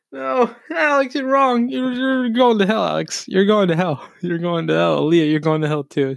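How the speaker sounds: squeaky mock preacher scolding Alex; playful high-pitched taunt; mock condemning. Teasing friends in high voice.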